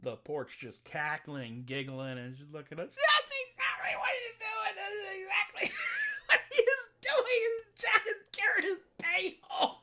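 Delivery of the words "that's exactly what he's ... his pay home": very high, loud words broken by laughter; shrieking with laughter. Acted. Daughter laughing to her father, shrieking the words.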